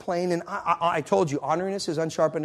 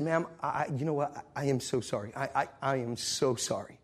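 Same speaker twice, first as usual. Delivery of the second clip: low, soft apology; earnest apology; 5 semitones lower, 8 dB quieter than usual. Retold or imitated. Traveler apologizing to upset older lady, low and earnest. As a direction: drop the voice and apologize gently.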